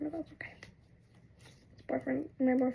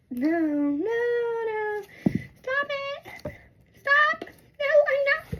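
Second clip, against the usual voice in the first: high, squealed mock crying; imitated crying; 12 semitones higher, much slower than usual. Stuck girl begging them to stop, high mock crying. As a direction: plead in a high fake-crying whine. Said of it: "I'm, like, crying".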